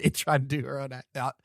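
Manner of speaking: ordinary talk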